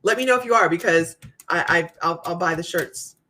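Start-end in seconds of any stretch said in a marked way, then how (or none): none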